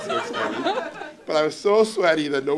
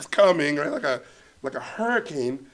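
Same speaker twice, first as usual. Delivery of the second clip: ordinary talk, nothing marked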